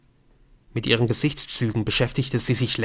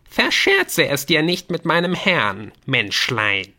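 high, loud gnome voice, dropping at the end; warning gnome; 6 semitones higher, 4 dB louder than usual. Acted. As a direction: warn them off as a squeaky gnome. Gnome warns the human. (drohender Gnom mit hoher Stimme)

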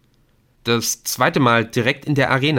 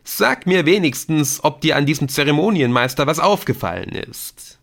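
mock despair; 6 semitones higher, 5 dB louder than usual. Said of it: "in gespielter Verzweiflung".

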